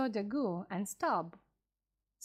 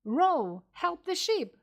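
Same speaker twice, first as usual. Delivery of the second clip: loud, rising commanding shout; urgent shout; 6 semitones higher, 4 dB louder, slower than usual. A narrator's reading. Captain ordering the men to save the ship, urgent shout. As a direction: bark the order to row. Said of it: "shouted Captain Ahab".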